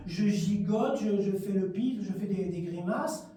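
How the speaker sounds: normal talking voice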